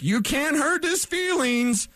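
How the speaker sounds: high, slow, mocking whine. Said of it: "part of society where it's like".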